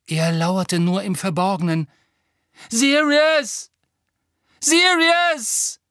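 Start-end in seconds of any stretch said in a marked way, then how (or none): 2.7-5.8 desperate high shouts of a name; calling out. A narrator's reading. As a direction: scream the name, pleading. Harry calls into the veil.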